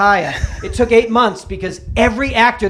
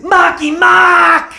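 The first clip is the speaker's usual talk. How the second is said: slow, high, loud Boston yell; hammed-up Boston shout of the nickname; 7 semitones higher, 6 dB louder, much slower than usual. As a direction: holler the name across the set. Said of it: "that's not his name".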